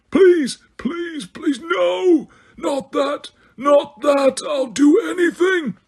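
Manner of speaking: high, crying screams of pure terror. A narrator's reading. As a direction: scream and beg as if tortured. Dumbledore crying out in torment.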